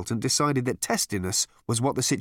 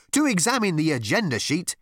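pitched-up, mildly strident officiousness; 7 semitones higher than usual. A narrator's reading. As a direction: direct the meeting stridently. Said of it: "said the girl with a strident voice".

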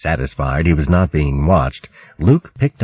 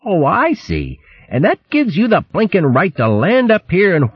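high, huffy scolding hare voice; blustering indignant hare; 9 semitones higher than usual. Acted. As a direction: scold the swordsman with wounded indignation.

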